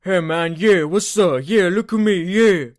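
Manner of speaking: loud, high goofy voice; weird put-on voice. Friend puts on a loud goofy voice for the host. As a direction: ham up a strange dopey voice. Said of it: "Why are you talking like that?".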